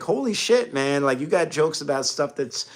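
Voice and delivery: an actor blown away by the jokes; high, amazed outburst from a fellow actor; excited disbelief. Retold or imitated. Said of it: "in character".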